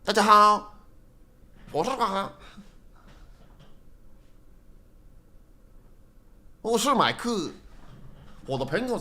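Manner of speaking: 中文频道主播腔; YouTube voice. Shown as a demonstration. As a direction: perform the upbeat channel intro. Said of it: "so that is my YouTube voice".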